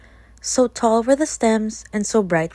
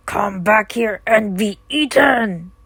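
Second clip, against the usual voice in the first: slow, louder shout; shouting wolf; 5 dB louder, slower than usual. Acted. Wolf chasing the girl, shouting. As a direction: bellow after her. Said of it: "shouted the wolf".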